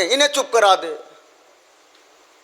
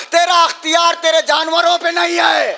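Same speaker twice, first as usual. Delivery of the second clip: raised, scornful shout at the tyrant; angry rebuke; 7 semitones higher than usual. Acted. Zainab shouting down Yazid in his court, angry.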